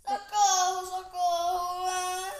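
loud frightened scream, crying; screaming for help. Real, not acted. Caught by the Pig.

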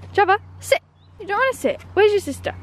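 high sing-song command; dog command. Real, not acted. Telling Java to sit in a high coaxing voice. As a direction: coax her to sit.

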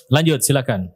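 usual speaking voice

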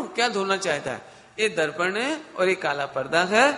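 ordinary talk, nothing marked

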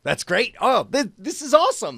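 rising high, excited praise; amazed. Real, not acted. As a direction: gush over the app. Amazed by the app.

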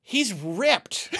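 ordinary talk

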